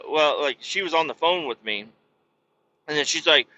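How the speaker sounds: ordinary talk, nothing marked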